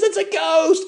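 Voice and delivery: high-pitched ghost alarm; mock alarmed cry. Somebody in the boat spots Jesus, mock alarmed cry. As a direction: cry it out in acted fright. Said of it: "And somebody says".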